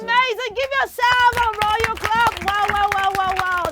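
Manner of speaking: high, loud run of delighted praise; excited cheer. Real, not acted. Host congratulates all the children together, excited cheer. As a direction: whoop up the crowd's applause.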